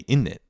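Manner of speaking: "In it" as one word, innit; run-together innit. Shown as a demonstration. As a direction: drop the z and t: "In it". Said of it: "You gotta say".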